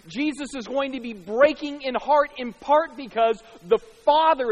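loud, rising sermon fervour; impassioned preacher building to a peak; preaching at full force. Acted. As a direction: drive home the heartbreak, pulpit voice. Preaching the descent.

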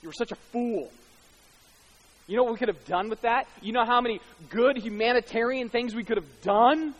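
high, loud indignant rebuke; acted scolding. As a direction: scold her over the waste, acting it out. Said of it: "And they scolded her".

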